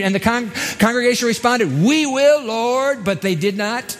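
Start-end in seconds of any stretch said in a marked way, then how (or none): 1.8-3.0 high, eager pious yes; eager agreement. Acted. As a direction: gush it like a keen churchgoer.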